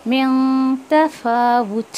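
मिन तफ़ावुत said slowly, मिन stressed; slow recitation. Shown as a demonstration. Teacher models the phrase for students. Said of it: "वाव के ऊपर पेश है".

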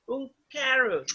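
slow, drawn-out carrot; slow model word. Shown as a demonstration. Teacher models carrot slowly for students. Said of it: "le vamos a poner un carrot".

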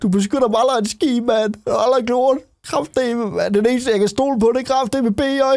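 high-pitched, upset accusation; aggrieved complaint. Real, not acted. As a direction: complain in a high, hurt whine. Accuses friend of never protecting him. (forurettet, højt klagende)

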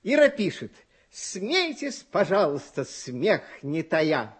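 ordinary talk

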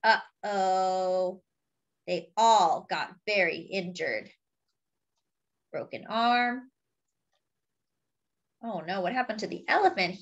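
usual speaking voice

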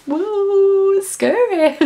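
high, drawn-out ghostly wail; mock spooky. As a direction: play at being scared, high and silly. Mock spooky about doing meetups.

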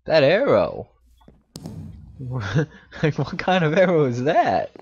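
loud, high-pitched astonishment with a laugh; laughing disbelief. Real, not acted. Player baffled by an arrow, loud laughing disbelief. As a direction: exclaim it loudly, half laughing.

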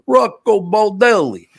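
put-on loud, high, showy Italian accent. Host imitating praise of Rocco Baldelli, big Italian accent. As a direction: belt the name in exaggerated Italian. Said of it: "you got the accent".

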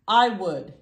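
a bold, loud reply; loud spiky answer. A narrator's reading. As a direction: answer boldly, prickly. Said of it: "answered a spiky sea urchin".

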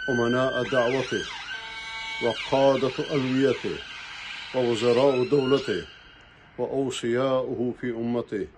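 ordinary talk, nothing marked

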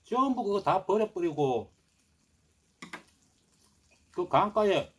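muffled words between bites; chewing while talking. Real, not acted. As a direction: mouth full of rice. Seen 0: Takes rice bite at 2.0s, chews while speaking afterward.

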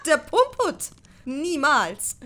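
high, loud, scoffing exclamation; incredulous, straight out of a laugh; disbelieving. A narrator's reading. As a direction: scoff as if it were absurd. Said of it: "voll Unglauben und brach in lautes Lachen aus".

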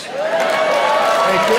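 very high squeaky thank you; mock thank you. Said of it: "just under seven years".

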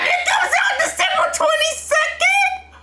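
very high, laughing, crying shout; excited shriek. Real, not acted. Fan shrieking at the release date. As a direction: scream with joy at the date.

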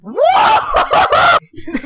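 high-pitched burst of laughter; real squealing laugh. Real, not acted. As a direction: crack up, high.

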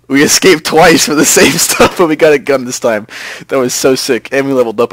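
loud, thrilled celebration; breathless-with-excitement victory cheer; elated triumph. Real, not acted. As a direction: crow about a narrow escape. Celebrating successful escape.

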